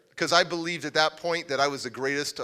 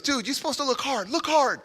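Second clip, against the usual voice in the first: urgent coaching; 9 semitones higher than usual. Retold or imitated. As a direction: imitate a friend's urgent aside. Retells friend urgently coaching him.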